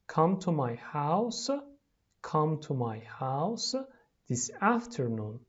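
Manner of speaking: slow phrases with pauses for repeating. Shown as a demonstration. Teacher modelling lines for pupils to repeat, slowly. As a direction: read it slowly, piece by piece.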